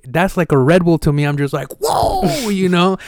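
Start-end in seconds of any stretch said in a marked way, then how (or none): 1.8-2.5 a high, stretched-out whoa; amazed whoa. Retold or imitated. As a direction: act blown away by the energy. Reacting to Rudy's energy.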